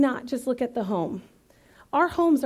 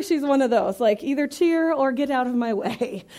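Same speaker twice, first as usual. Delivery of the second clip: ordinary talk, nothing marked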